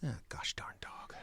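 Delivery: soft, whispered curse; whispered grumble. Acted. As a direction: grumble it under your breath. Muttered frustration.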